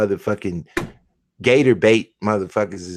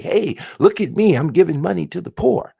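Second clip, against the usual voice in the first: mock showoff; 6 semitones higher than usual. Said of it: "I'll be like".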